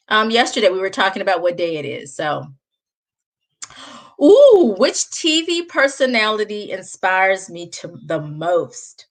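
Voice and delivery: normal talking voice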